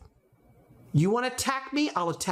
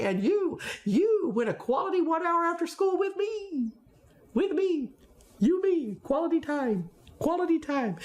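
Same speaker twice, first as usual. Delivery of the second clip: higher, playful mock offer of quality time; joking teacher; 7 semitones higher than usual. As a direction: tease the kid with a cheerful prize. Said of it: "a jokingly thing about my detentions".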